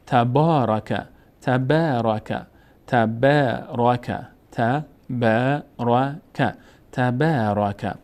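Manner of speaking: تبارک recited slowly with a long aa on با; تلاوت آرام تبارک; slow recitation. Shown as a demonstration. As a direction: model تبارک for pupils, drawing out the alef.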